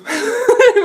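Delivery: loud high burst of laughter; a joyful emotional laugh; happy laugh. Real, not acted. Gushing about the parent's love, bursting into laughter. Laugh after beautiful ending remark.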